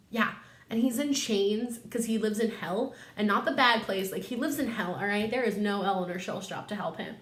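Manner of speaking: ordinary talk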